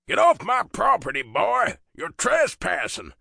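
put-on loud, high, biting southern accent; southern-accented shout. A narrator's reading. Father ordering biker off his property, southern shout. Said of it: "he shouts, his thick southern accent biting out every word".

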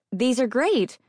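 high, overly cheerful fib; fake enthusiasm. A narrator's reading. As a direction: sell the lie brightly. Narrator feigns enthusiasm.

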